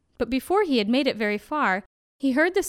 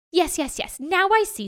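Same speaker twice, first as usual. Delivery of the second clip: high, eager jackal; 7 semitones higher, 3 dB louder than usual. A narrator's reading. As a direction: agree brightly as if it finally makes sense. Jackal pretends to understand tiger.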